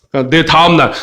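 ordinary delivery

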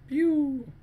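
high playful shot noise; light pew as he shoots; pew sound effect. Pretend. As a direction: fire a mouth-made shot. Mimicking a shot.

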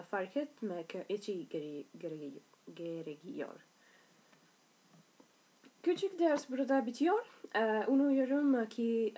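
normal talking voice

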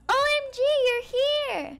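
high, thrilled greeting; high-pitched surprised delight; excited squeal. Acted. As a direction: gush over her arrival. Greeting returning sister.

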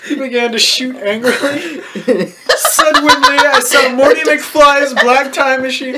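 ordinary talk, nothing marked

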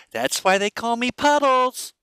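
put-on high, slow, goofy girl voice; stupid-faced quip. Drunk girl excusing peeing on couch, high goofy drawl. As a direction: draw it out high and silly. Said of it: "she makes this really stupid face".

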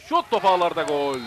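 loud, high goal call; screaming football commentator. Real, not acted. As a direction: shout the goal out. Goal scored, commentator erupts.

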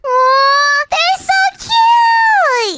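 high, slow, gushing coo; delighted aww. Acted. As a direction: melt over the cute babies. Pet sees axolotls.

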